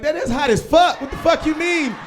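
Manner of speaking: high, loud disbelieving shout; outraged shout. Retold or imitated. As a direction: yell it at dad in disbelief. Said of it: "I'm like".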